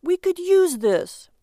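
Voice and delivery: high, loud, drawn-out plea; pleading protest. Acted. Husband defending his purchase to wife, protesting. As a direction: insist on it, stretching the words.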